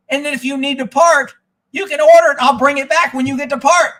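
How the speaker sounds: high, loud, exasperated; retold shouting match with the dealer; angry retort.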